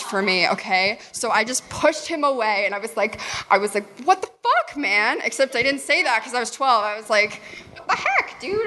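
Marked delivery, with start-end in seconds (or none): 4.0-5.2 high-pitched, mock-outraged protest; retold shocked outburst. Acted outburst at boy pushed away. 7.8-8.7 very high-pitched squeak of protest; retold shocked protest. Cleaned-up retold protest, twelve years old.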